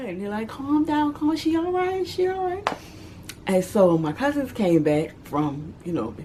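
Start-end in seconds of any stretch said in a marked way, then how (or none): 0.5-2.7 high, soft calming voice; soothing relatives. Retold or imitated. Relatives calming the crying child, high and soft. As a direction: hush the crying kid.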